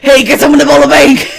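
very high, loud holler; full shout. Acted. Bored police chief calling for a bank robbery, high full shout.

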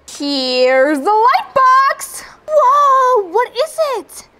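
a kid pretending to be awestruck; mock amazement. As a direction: act dazzled by the reveal. Reacting to the light box reveal.

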